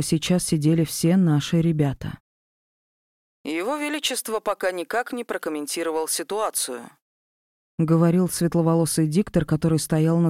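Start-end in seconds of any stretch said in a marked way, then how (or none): 3.4-6.9 news anchor. Acted.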